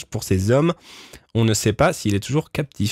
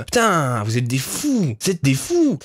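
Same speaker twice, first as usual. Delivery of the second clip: amazed; slower than usual. Real, not acted. Streamer amazed by chat's document.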